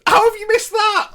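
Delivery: shocked high yelp at the miss; stunned, voice jumps way up; disbelieving. Real, not acted. Disbelief at missed chance.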